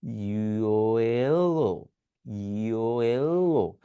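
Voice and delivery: "Yellow" drawn out very slowly; slow motion. Shown as a demonstration. Teacher contrasting a slow yellow with a direct one. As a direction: stretch every part of "yellow". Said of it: "slow মোশনে বলতেছি".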